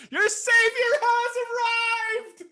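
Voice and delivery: drawn-out, very high herald's yell; yelled proclamation. Acted. Illusionist announcing a savior amid fireworks, slow high yell. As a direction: slowly bellow it high across the water. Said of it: "and I yell".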